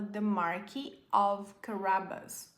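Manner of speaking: "Marquis" as marki, no s; silent s. Shown as a demonstration. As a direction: zeg "Marquis" zonder s aan het eind. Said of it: "apesar de ter esse S aqui, a gente não fala".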